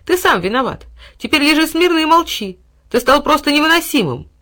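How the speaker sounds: high, loud stern scolding; scolding boy. A narrator's reading. Boy scolding his bound dog. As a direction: scold him sharply.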